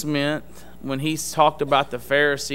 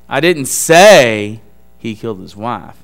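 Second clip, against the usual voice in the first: "say" stretched out loud, then a drop; stress on SAY; 4 semitones lower, 8 dB louder than usual. Shown as a demonstration. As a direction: punch the word "say" and hold it before going on. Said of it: "Where you emphasize the word".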